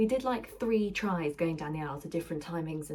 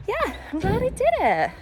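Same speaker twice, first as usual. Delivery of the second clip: excited; 12 semitones higher, 8 dB louder than usual. Real, not acted. Pleased after first run.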